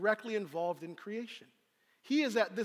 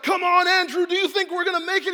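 high, loud, fast shout over the storm; panicked disciple shout; 10 semitones higher, 12 dB louder than usual. Acted. Philip calls to Andrew in the storm, panicked shout. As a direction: yell to Andrew as the boat rocks. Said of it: "Philip's like".

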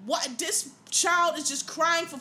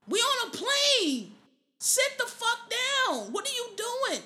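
higher and slow, "We on a plane" stretched out in disbelief; exasperated outburst; 9 semitones higher, slower than usual. Real, not acted. The vlogger scolds unruly kids on a plane in a high, slow, exasperated voice.

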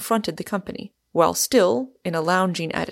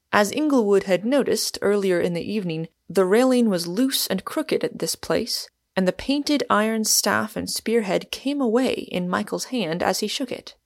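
plain reading voice

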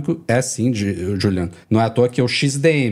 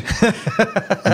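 amused laugh; 9 semitones higher than usual. Real, not acted. Host laughs at the guest.

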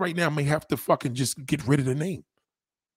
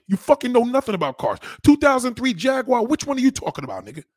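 louder and higher, heating up; angry rant; 5 semitones higher, 4 dB louder than usual. Real, not acted. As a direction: lay into him, getting louder and higher. Heated car argument with commenter.

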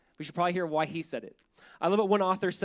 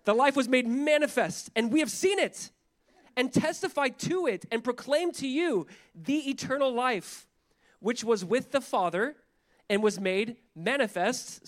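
higher, proclaiming verse reading, slowing toward the end; emphatic scripture reading; 5 semitones higher, 3 dB louder, slower than usual. Real, not acted. Pastor proclaims First John to the congregation, emphatic. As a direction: proclaim it phrase by phrase. Reads scripture aloud with rising force.

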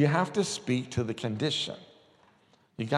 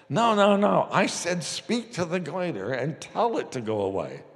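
amused correction; 6 semitones higher than usual. Retold or imitated. Wimber laughing at Bill's prayer, amused correction. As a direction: correct him, half amused.

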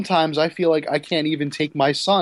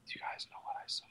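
whisper; 23 dB quieter, much faster than usual. Acted. Child builds suspense to whisper.

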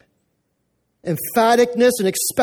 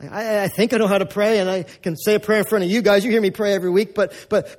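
ordinary talk, nothing marked

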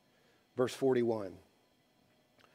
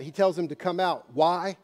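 normal talking voice

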